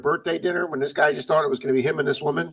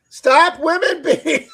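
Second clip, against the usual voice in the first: words dissolving into a high, loud shrieking laugh; cracking up laughing; 5 dB louder, slower than usual. Real, not acted. Host reading a chat comment aloud, breaking into loud laughter. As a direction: lose it mid-sentence and howl with laughter. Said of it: "Sorry, I'll stop".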